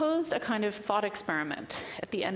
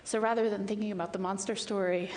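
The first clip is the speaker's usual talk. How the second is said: ordinary delivery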